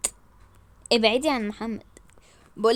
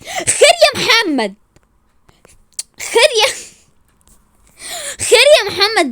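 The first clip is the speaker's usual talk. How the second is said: outraged challenge; 7 semitones higher, 5 dB louder than usual. Acted. Wife confronts Mohamed for defending rival, outraged. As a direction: challenge him indignantly.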